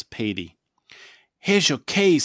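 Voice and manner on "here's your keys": loud, high friendly little hot dog; hot dog's hello. A narrator's reading. Living hot dog greeting Petey, high and loud. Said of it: "says the hot dog".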